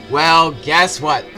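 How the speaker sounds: normal talking voice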